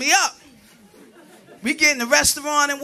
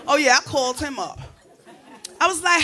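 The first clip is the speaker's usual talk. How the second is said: ordinary delivery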